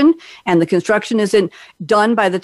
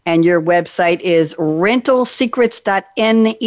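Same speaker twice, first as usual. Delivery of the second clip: usual speaking voice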